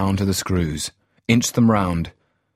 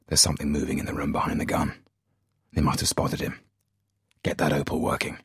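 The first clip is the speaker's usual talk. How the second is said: whisper; 3 semitones lower, 4 dB quieter, faster than usual. Acted. Spotter warns during tense stakeout.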